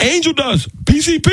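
very high, gleeful Tracy voice; Tracy Morgan impression. Tracy revealing the joint was PCP, high gleeful voice. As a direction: squeak out the reveal. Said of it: "He's like".

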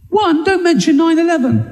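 high-pitched incredulous reprimand; indignant reproach. Acted. Comedian correcting the air hostess's blunder, indignant and high.

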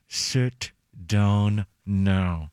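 low, very slow controlled anger; each word drawn out through a scary smile; gritted teeth. Shown as a demonstration. Dad at his angriest without yelling, menacingly low and slow. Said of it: "I've gone to the gritted teeth".

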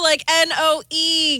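"N O E" spelled letter by letter, slowly; spelling it out. Shown as a demonstration. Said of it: "with the no".

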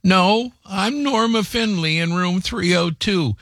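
put-on high, relieved old lady voice; old grandmother. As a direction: gush thanks like a sweet grandma. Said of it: "The grandmother said".

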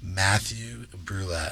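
very slow, quiet name; slowly. Shown as a demonstration. Guest giving name for the host, slowly. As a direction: space the name out slowly and softly. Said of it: "Say that slowly".

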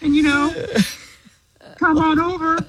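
high, bright announcer voice; game show announcer. Acted. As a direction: sell the prize like a TV announcer. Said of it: "Johnny, tell her what she's won".

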